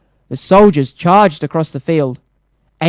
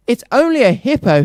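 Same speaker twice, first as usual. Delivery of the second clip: sighed; 7 semitones higher than usual. Acted. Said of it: "he sighed".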